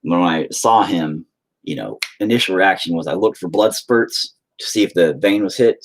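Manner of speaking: normal talking voice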